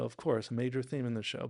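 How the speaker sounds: ordinary talk, nothing marked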